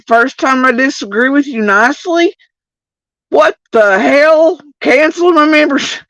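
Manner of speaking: shrill mock rant of a banned fan; mock outraged viewer. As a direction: play the furious long-time member. Angry chat members quoted.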